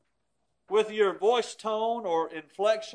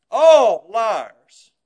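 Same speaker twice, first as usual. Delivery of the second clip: preaching shout; 11 dB louder than usual. Acted. Preaching emphasis.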